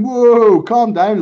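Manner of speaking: loud, high imitated "whoa"; calling for calm. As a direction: call out over a squabble to settle it. Bobby calming the angry group.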